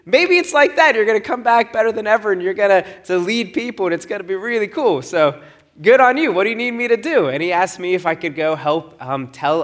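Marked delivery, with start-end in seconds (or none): 0.0-7.3 higher, louder upbeat encouragement; pep talk. Retold or imitated. Telling his friend he'll come back better, upbeat. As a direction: sell the adventure with enthusiasm. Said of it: "I remember telling him".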